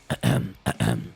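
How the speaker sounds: gentle throat clearing; soft throat clear. Shown as a demonstration. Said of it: "you try to clear out, like".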